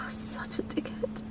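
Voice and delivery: slow, very soft whisper; sigh, then breathe it out under your breath; hushed, voiceless scolding of yourself; whispered self-reproach. Real, not acted. Scolding self after crying.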